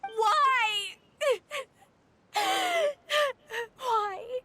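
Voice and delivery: high-pitched, sobbing wail; anguished crying. Acted. Condemned member crying out at the apology, anguished. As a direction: cry it out in despair, breaking into sobs.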